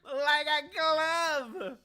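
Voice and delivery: high, loud, very slow drawn-out "glove"; gleeful exclaim. Real, not acted. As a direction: stretch each word in delighted triumph. Proudly showing the old sweater.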